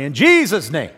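loud, proud In Jesus' name; emphatic prayer close. Retold or imitated. Preacher recalls praying boldly, loud declaration. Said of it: "And we pray".

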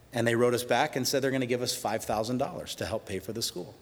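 ordinary delivery

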